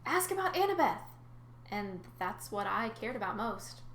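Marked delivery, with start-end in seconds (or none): 0.0-1.1 inner scream. Acted. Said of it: "was screaming".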